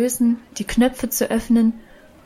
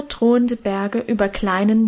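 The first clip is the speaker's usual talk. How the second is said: usual speaking voice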